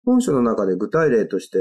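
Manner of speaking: ordinary talk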